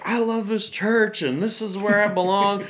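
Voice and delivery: high, gushing retold outburst; overcome gushing. Speaker voicing a man given money at church, overcome gushing. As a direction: gush as the overwhelmed man.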